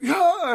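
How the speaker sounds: high, loud anime battle cry; hammed-up warrior yell; imitated power-up yell. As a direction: burst into a mock transformation scream. Imitates Super Saiyan transformation yell.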